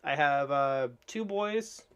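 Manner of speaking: ordinary talk, nothing marked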